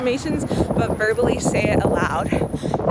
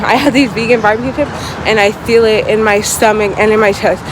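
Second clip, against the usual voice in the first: usual speaking voice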